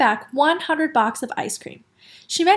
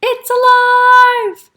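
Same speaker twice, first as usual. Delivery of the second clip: high, loud shriek of terror; screaming; 11 semitones higher, 9 dB louder, much slower than usual. A narrator's reading. Lady finding the doll alive, screaming. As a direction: scream it, dragging out the last word. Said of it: "The lady screamed".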